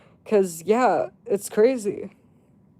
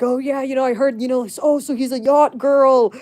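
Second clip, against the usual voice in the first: mock agreement; 4 semitones higher than usual. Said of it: "I was gassing her up".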